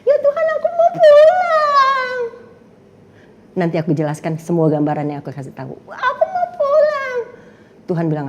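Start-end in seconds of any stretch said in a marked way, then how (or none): 0.0-2.2 put-on high, slow childlike wail; high slow child's cry to God; like a small child. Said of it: "kayak anak kecil". 5.8-7.2 put-on high, slow childlike wail; high slow child's cry to God; like a small child. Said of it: "kayak anak kecil".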